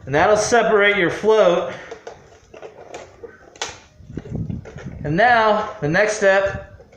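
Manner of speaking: talking through a respirator mask; muffled behind a face mask. Real, not acted. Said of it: "I apologize if my voice is a little muffled".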